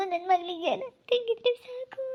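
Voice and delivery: high, wailing plea; crying lament, pitched high; tearful pleading. Acted. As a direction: sob it out as a desperate parent. Mother crying for missing daughter.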